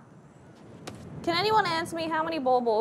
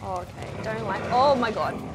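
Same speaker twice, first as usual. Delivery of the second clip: ordinary talk, nothing marked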